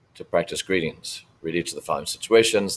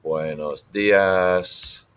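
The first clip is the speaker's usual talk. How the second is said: slow model phrase; much slower than usual. Shown as a demonstration. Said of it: "Either "Hola" or".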